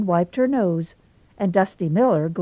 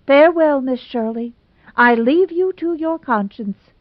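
higher, louder, grand tragic exit; dramatic farewell; 7 semitones higher, 5 dB louder than usual. Acted. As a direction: play the queen going to the scaffold. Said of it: "turned there dramatically".

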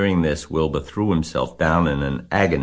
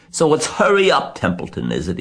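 mocking imitation of Wilbur; 10 semitones higher, 5 dB louder than usual. Templeton imitating Wilbur's voice, mocking imitation. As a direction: parrot the pleading in mockery. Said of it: "He began imitating Wilbur's voice".

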